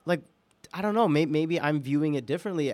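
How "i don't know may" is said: normal talking voice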